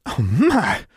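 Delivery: breathy high Southern exclamation; southern belle. A narrator's reading. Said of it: "A feminine voice with a lilting southern accent".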